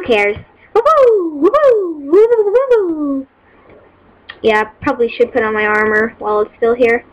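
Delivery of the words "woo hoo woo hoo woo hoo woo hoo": high, bright whooping; real happy woo-hoos; excited whoops. Real, not acted. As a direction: cheer it out in glee. Cheering while leaving.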